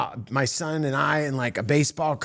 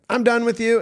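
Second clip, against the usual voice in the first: higher, louder mock brush-off; abrupt dismissal; 7 semitones higher, 5 dB louder than usual. Ray hears he isn't into football, abrupt mock dismissal. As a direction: imitate cutting someone off, pitched up and loud.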